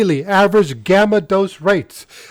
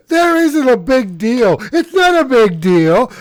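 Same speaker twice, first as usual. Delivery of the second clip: mocking; 7 semitones higher, 3 dB louder than usual. Mocking opponents' dismissive claim.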